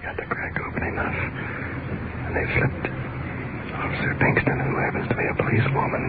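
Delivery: very soft, voiceless play-by-play; hushed report from just outside the raid; real whisper. Real, not acted. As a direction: whisper each step of the break-in as it happens. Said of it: "We can't speak over just a whisper".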